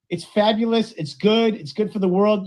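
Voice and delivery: usual speaking voice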